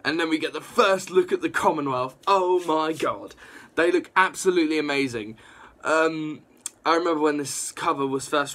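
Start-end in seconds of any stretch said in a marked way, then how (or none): none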